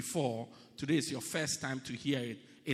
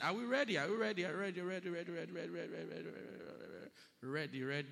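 repeated ready, fading to near silence; fading call; 5 dB quieter, much faster than usual. Real, not acted. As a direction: let the ready dwindle away. Preacher rouses congregation, fading.

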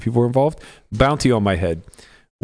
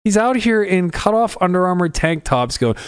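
normal talking voice

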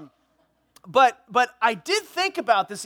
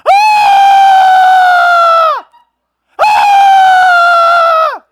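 long, very loud high shriek; blood-curdling scream; 15 dB louder than usual. Acted. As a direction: scream at full force in horror. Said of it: "Just this blood-curdling scream".

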